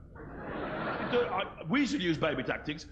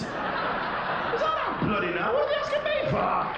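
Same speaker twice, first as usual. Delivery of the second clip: much higher, slow laughing outburst; exasperated squeal; slower than usual. Acted. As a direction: throw up your hands at the kids.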